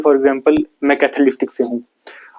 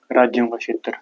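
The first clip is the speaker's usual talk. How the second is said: softer, measured dictation phrase; quiet dictation; 2 semitones lower, 5 dB quieter than usual. Real, not acted. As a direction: repeat softly for note-takers. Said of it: "लिखो हिंदी वाले". (धीमी आवाज़ में लिखवाते हुए)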